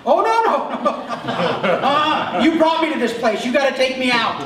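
high, loud, laughing refusal; laughing panicked protest. Retold or imitated. Pastor retelling pleading with God to get him out, laughing mock panic. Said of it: "laughing protest to God".

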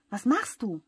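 alarmed scolding. A narrator's reading. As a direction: tell them off sharply. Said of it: "schimpft die Luftmatratze".

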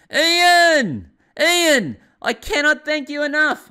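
ecstatic, shouting caller; overjoyed shout. Retold or imitated. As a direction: yell his name down the phone like a man saved. Grateful client calls overjoyed.

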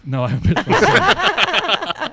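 high, slow laughing protest; laughing denial. Real, not acted. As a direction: laugh it out while denying it. Indignant denial while laughing.

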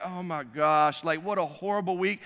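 sinking dismay. Retold or imitated. Driver seeing police lights behind him, sinking dismay. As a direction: let it sag, as if to yourself. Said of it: "I'm like".

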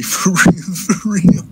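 high laughing "for real". Real, not acted. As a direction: laugh it out twice. Laughing emphatic repeat.

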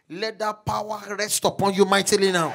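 loud, high, shouted prophecy; full-force preaching. Acted. Pastor prophesying power over the congregation, shouting. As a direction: climb to a roaring climax.

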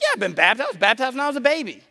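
put-on high-pitched, protesting kid retort; naive insistence. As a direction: replay a boy confidently insisting, pitch climbing. Replays naive teenage answer to friend.

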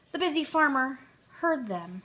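ordinary delivery, nothing marked

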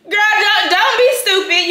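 high, loud don't be stupid; scolding call-out. Real, not acted. Telling the viewers off, loud and high.

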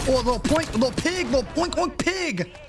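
high mock oinking at an enemy; piggy oink taunt; pig noises. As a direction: taunt him with squealing oinks.

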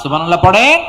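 loud call, rising at the end; loud prompt. Real, not acted. Preacher asking the congregation to praise, loud rising call. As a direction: rouse the crowd to respond. Calls congregation to respond after song.

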